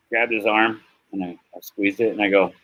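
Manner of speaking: ordinary talk, nothing marked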